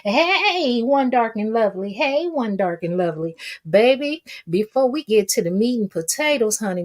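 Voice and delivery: usual speaking voice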